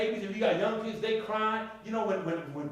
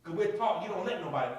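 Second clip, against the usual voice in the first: normal talking voice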